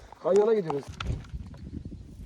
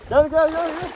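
loud beckoning call; 4 semitones higher, 6 dB louder than usual. Real, not acted. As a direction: call them over loudly. Calling friends into water.

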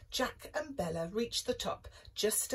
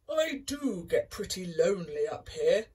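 lonely giant confession; 5 dB louder than usual. Acted. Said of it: "confessed the giant".

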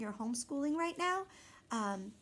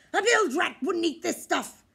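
loud, higher gruff complaint; disgusted pirate; 6 semitones higher, 9 dB louder than usual. A narrator's reading. Pirate refusing the baby food. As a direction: scoff at the food.

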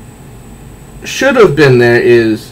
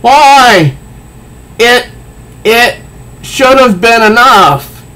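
high, loud anguished cry; anguished Riddler; 10 semitones higher, 3 dB louder, much slower than usual. Acted. Riddler despairing at his defeat, high and loud. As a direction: wail at the unfairness. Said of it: "he says".

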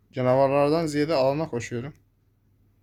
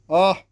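pain cry; 5 semitones higher, 7 dB louder than usual. Real, not acted. Takes damage in game.

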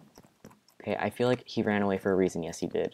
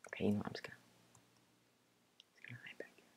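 very soft whispered self-talk; hushed whisper; 17 dB quieter, much faster than usual. Real, not acted. Player hiding back here, hushed whisper. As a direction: whisper it as you hide.

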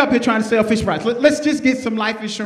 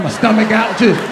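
ordinary talk, nothing marked

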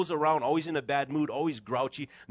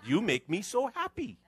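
higher, bright and kind; sweet reply; 8 semitones higher than usual. Shown as a demonstration. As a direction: turn it into a kind compliment. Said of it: "Try that one".

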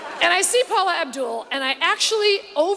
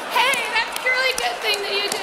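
[name] impression; 6 semitones higher than usual. Mocking [name]'s drugged praise.

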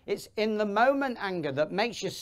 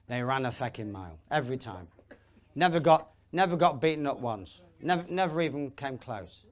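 usual speaking voice

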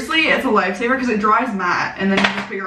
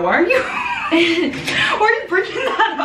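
laughing; 8 semitones higher than usual. Real, not acted. Laughing disbelief at friend.

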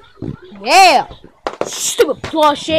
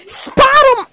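ordinary delivery

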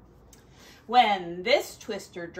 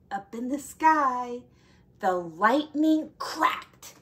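plain reading voice

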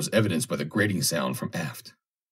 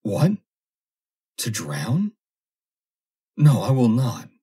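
terrified refusal; 5 semitones higher, 4 dB louder, slower than usual. Acted. Foster refuses in terror.

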